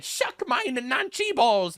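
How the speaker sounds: high, drawn-out German caricature; hammed-up defiant German; mock German. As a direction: stretch it out in a fake German accent. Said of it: "what Germany should have said".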